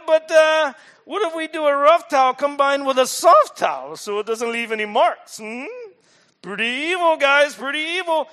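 a cartoon schemer delighting in cruelty; gleeful villain voice. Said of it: "Now, I'm gonna use accents".

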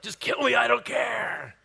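hammed-up high, drawn-out shout; hangry outburst. Preacher acting out a hangry Elijah, loud exasperated shout. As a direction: yell it like an overdramatic hungry man giving up. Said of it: "getting hangry in Scripture".

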